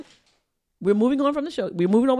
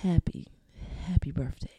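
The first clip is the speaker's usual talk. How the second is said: low, soft seductive voice; sexy ASMR voice; 7 semitones lower, 3 dB quieter than usual. Pretend. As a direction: croon it low and intimate. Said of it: "And my sexy A S M R voice".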